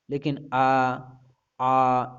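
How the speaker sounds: आ held with mouth open; आ को खुले मुँह से खींचना; open vowel demo. Shown as a demonstration. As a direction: let the आ flow out unblocked. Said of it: "जब आप स्वरों का उच्चारण करते हैं".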